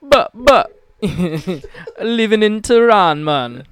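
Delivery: loud, high, slow hammed-up Persian accent; bad Iranian accent. Host riffing a mock Iranian man, loud high. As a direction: lay on a cartoonish Tehran accent. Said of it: "the Iranian man".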